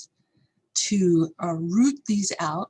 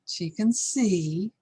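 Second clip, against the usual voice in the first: ordinary talk, nothing marked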